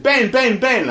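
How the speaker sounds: high, loud call across a room. Retold or imitated. Calling a colleague over across the room, loud call. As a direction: shout his name to get him over.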